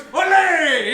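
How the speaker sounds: loud, high theatrical cry; hammed-up swashbuckler's flourish; flamboyant shout. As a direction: shout it like a showy matador. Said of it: "He goes".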